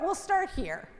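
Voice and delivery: ordinary delivery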